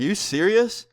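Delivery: high-pitched incredulous question; retold shock, pitch jumping up; retold disbelief. Storyteller reacting to his friend's knife story, retold disbelief.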